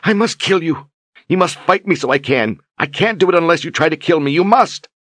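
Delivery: high and loud, rushing, near hysteria; frantic, desperate insistence. A narrator's reading. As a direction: force the words out in frenzy. Berdine frantic after screaming.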